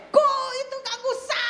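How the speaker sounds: high, loud accusing voice of a possessed woman. Retold or imitated. Possessed woman snarls at the preacher, high and loud. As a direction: shriek the accusation like the spirit speaking. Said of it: "Lalu dia tunjuk saya".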